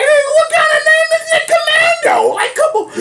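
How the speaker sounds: very high-pitched disbelief; cracking up in disbelief; incredulous squeal. Real, not acted. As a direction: question it in a squeal. Incredulous at a silly name.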